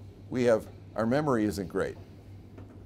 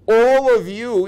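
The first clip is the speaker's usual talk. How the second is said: ALL OF YOU very high, loud and slow; slow stress on ALL; 12 semitones higher, 12 dB louder, much slower than usual. Shown as a demonstration. Key phrase drawn out.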